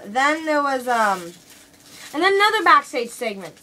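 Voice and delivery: usual speaking voice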